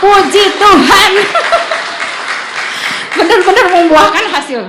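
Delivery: loud, high cry of praise breaking into laughter; jubilant. Real, not acted. Wife celebrating her husband's Amen, jubilant laughing shout. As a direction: exult at full voice, laughing. Joy at husband's first reply.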